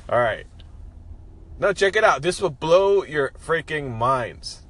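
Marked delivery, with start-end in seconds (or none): 1.6-4.6 pitched-up, louder build, slowing down; showman's hype. Pretend. Host teasing his big idea to listeners, showman's hype. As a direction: sell it like a mind-blowing reveal.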